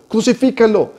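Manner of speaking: high-pitched crowd cry at little extra volume; mild mock shout. The crowd answering Pilate, mild high mock shout. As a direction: call it out high, a restrained imitation of shouting. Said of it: "le contestaron a gritos".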